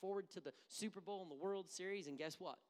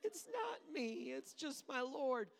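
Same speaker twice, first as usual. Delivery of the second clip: high, slow, whimpering false humility; mock pious crying; 13 semitones higher, slower than usual. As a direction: overdo the weepy modesty, slowly. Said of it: "Somebody says".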